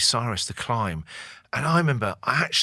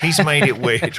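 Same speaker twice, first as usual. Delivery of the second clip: laughing mock mate; 5 semitones higher, 7 dB louder than usual. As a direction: repeat it louder, laughing through the words. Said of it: "they're gonna go".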